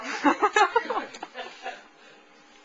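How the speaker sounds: high, loud burst of laughter. Real, not acted. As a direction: burst out laughing mid-apology. Laughs after the lewd poem.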